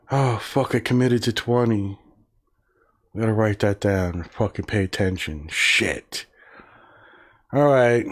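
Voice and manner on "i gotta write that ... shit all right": ordinary delivery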